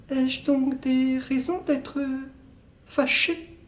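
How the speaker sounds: soft high female role; woman's voice. Acted. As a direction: play the distant lover, light and airy. Woman's part in two-voice scene.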